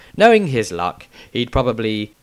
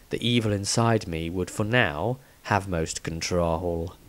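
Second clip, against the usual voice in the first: quiet scheming inner voice; inner evil thought; 5 semitones lower, 9 dB quieter than usual. Acted. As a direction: think it darkly to yourself.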